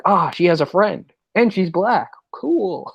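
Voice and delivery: a hint of a giddy nerdy guy; mock delighted nerd. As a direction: sell the news like a thrilled nerd. Nerdy agent's friend acted out.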